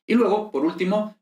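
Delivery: usual speaking voice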